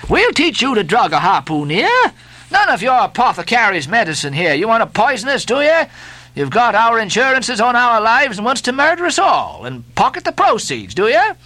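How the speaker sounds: high, heated accusation; accusing mate. Acted. Stubb accusing the steward of poisoning them, heated. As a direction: threaten him with mock outrage.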